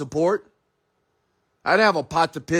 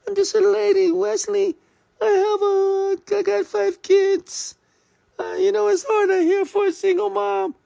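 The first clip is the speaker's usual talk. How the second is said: mocking whiny woman; 14 semitones higher than usual. Mocking a woman who makes excuses to her boss, in a high whining falsetto. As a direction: squeak it out like a self-pitying woman, near tears. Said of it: "'Cause you might say".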